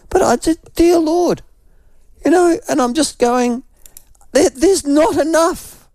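pinched, high, strained voice; strained sick croak. Real, not acted. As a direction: squeeze it out like you're ill and dried up.

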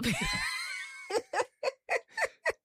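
very high, squealing laugh; laughing fit. Real, not acted. Host laughing at own birthday singing, high laughing fit. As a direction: break into a long, high-pitched laugh.

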